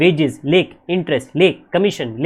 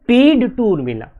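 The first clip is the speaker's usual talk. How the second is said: animated setup; 6 semitones higher, 5 dB louder than usual. Shown as a demonstration. Teacher raising a story.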